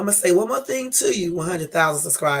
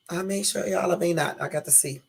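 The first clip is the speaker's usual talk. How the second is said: normal talking voice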